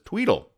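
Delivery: high chirpy tweedle ring; high-pitched tweedle ring; phone ring. Retold or imitated. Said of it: "That's the sound effect".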